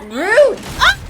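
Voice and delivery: short loud high outcry; indignant yelp. Real, not acted. Player hit by a monster, indignant yelp. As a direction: bark it out, offended.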